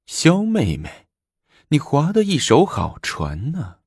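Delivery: slow, high, drawn-out mock sweetness; snide, sarcastic. A narrator's reading. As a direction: stretch the words out with mocking politeness. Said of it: "坐在那里阴阳怪气地说". (阴阳怪气地拖长声调)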